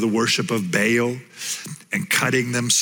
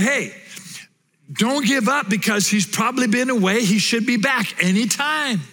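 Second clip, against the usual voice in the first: raised-pitch teasing, sarcastic encouragement; mock the idol worshippers from higher up; mocking taunt; 8 semitones higher than usual. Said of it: "he's just making fun of them".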